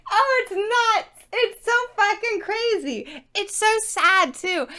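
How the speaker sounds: high, loud laughing amazement; cracking up at how wild it is; laughing disbelief. Real, not acted. Reviewer reacting to the book's twist, laughing in disbelief.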